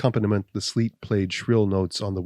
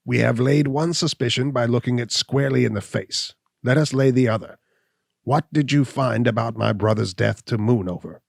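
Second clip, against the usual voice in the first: higher, louder, cocky hunchback voice; hunchback Gall, sneering; 3 semitones higher, 4 dB louder than usual. Acted. Gall taunting Abner, a higher, louder sneering voice. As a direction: voice Gall as he mocks the suspicion.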